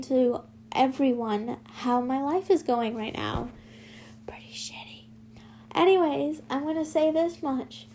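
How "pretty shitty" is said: barely voiced, soft aside; whispered admission. Real, not acted. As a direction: let it slip under the breath. Muttered admission.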